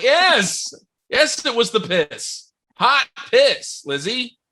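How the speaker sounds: high, loud, slow exasperation; exasperated. Retold or imitated. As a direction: wail it at Lizzie, fed up. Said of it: "I was like".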